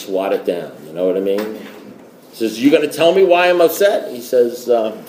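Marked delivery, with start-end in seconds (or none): 2.6-4.1 higher, exasperated rising question; acted indignation. Caller presses sponsor for answer, acting exasperated. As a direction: push the question up in mock disbelief. Retells his demand to sponsor.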